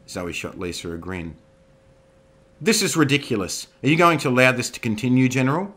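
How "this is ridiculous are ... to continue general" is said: loud, fast outraged protest; indignant. Acted.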